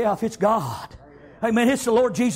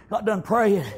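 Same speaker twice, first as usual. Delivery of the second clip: ordinary talk, nothing marked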